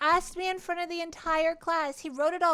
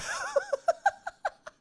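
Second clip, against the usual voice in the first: high squealing laugh. Real, not acted. Streamer retelling her first kiss, squealing laughter. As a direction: cackle helplessly at the memory.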